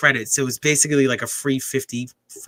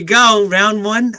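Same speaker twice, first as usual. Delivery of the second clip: yelling from the sidelines; 7 semitones higher, 5 dB louder, much slower than usual. Real, not acted. Cheering a fight live.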